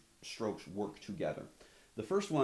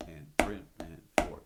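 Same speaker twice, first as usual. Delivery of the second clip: slow, steady counting over the drum; calling the counts over the drumming; slow counting while playing; 5 dB louder, slower than usual. Shown as a demonstration. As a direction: count it out slowly and loud enough to hear over the drums. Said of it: "we're gonna go one and two".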